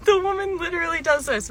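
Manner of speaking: high, teary laughter through the words; can barely get it out for laughing; laughing hard. Real, not acted. Laughing while retelling the customer.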